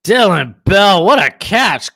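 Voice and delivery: loud, high, slow-building exclamation; real burst of amazement; excited game call. Real, not acted. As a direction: shout it like an amazed commentator. Reacting to a big catch live.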